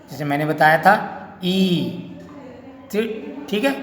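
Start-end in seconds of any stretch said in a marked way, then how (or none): 1.4-1.6 ई held long; long drawn vowel. Shown as a demonstration. Teacher recalling what was shown, ई drawn out. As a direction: stretch the vowel ई. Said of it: "इसको थोड़ा खींचेंगे".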